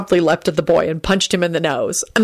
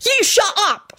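high-pitched angry outburst; furious shout; slower than usual. A narrator's reading. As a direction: explode at the bully. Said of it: "Timothy shouted furiously".

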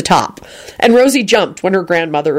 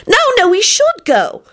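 put-on much higher insistent girl. A narrator's reading. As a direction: squeak it out insisting. Rosie repeating, very high.

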